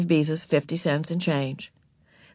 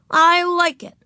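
put-on high, loud little-girl defiance; stubborn; 13 semitones higher, 6 dB louder than usual. A narrator's reading. Ramona unashamed before Mother, stubborn and high. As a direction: insist like an unrepentant small child. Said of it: "said Ramona stubbornly".

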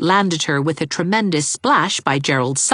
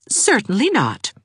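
stately; 8 semitones higher than usual. A narrator's reading. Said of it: "Anne was at her stateliest".

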